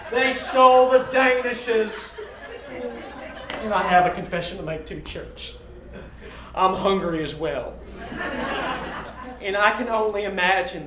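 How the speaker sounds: lightly voiced hungry preacher; televangelist voice. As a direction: plead for food like a TV pastor. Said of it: "A, a televangelist?".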